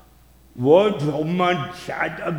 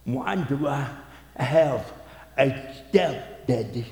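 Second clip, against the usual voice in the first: much softer, lower, consoling voice; tender and gentle; 3 semitones lower, 4 dB quieter than usual. Retold or imitated. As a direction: speak softly to a hurting wife. Husband gently explains wife's pain.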